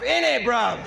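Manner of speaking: a cheeky British echo; British slang thrown back at the opponent; British accent. Acted. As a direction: repeat "In it, bruv" in the same light British accent. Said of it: "killing it with the U K accent".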